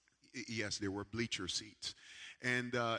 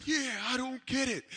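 high, louder exasperated reply; indignant retort; 12 semitones higher, 5 dB louder than usual. Retold or imitated. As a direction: fire it back at the friend. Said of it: "And I said".

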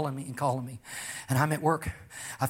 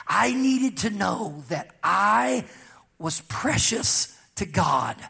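slow, weighty preaching building louder; emphatic preaching; 4 dB louder, slower than usual. Real, not acted. Preacher testifying to congregation about God's healing, slow and forceful. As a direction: stretch each phrase out and drive it home.

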